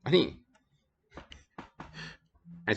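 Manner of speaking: ordinary delivery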